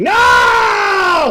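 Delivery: high, loud cry; jolting yell of fright; startled shout. Acted. Driver suddenly gasps.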